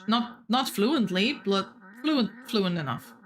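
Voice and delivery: normal talking voice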